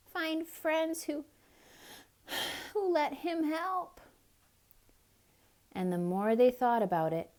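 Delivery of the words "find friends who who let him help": high little regretful voice, halting; sighing squirrel. A narrator's reading. Squirrel imagining duck's kinder friends, sighing. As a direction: sigh through it, high and hesitant. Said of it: "sighed the squirrel".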